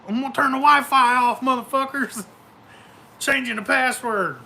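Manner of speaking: high, loud mock threat to the kids. Dad threatening kids to cut the Wi-Fi, high loud mock. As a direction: threaten them like a fed-up dad.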